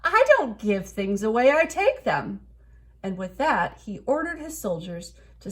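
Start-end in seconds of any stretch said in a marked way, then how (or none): 0.0-2.5 loud, haughty retort; greedy king's retort. A narrator's reading. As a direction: throw it back proudly. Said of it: "cried the king".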